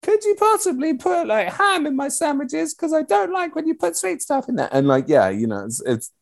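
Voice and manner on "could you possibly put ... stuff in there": put-on very high, sweet little-boy voice; polite little boy. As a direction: ask Mummy nicely in a tiny child voice. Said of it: "total chameleon".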